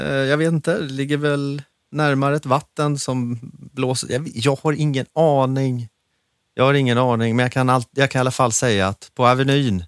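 ordinary talk, nothing marked

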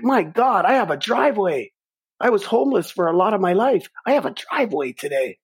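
the voice lifts high in awe; awed gratitude. Real, not acted. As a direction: marvel at your own blessings. Sudden grateful realization.

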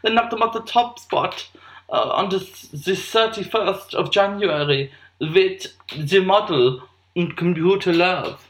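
put-on camp, drawn-out German accent. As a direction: read it as a camp German presenter. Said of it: "When I do a German accent".

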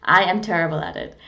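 ordinary talk

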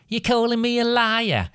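very high, shrill creature squeal. A narrator's reading. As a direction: shriek it way up high, indignant. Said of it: "answered in an equally shrill voice".

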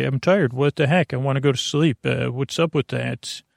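normal talking voice

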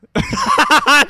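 loud, high-pitched laugh; big high laugh; burst of laughter. Real, not acted. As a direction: burst out laughing at full volume.

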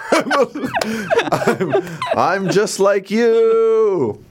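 very high, loud, drawn-out mock voice; put-on comedian voice. Rich comedian claiming kinship with the crowd, silly drawn-out voice. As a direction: stretch it slowly in a silly high pitch. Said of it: "That's my voice".

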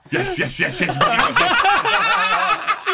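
high, slow, laughing mock agreement; laughing yes. Ian Wright eggs him on, high laughing yeses. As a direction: cackle out the yeses.

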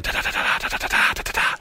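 softly voiced rat-a-tat of distant gunfire; mimicking far-off gunfire during meditation; gunshot imitation. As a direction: tap out the gunshots with the tongue.

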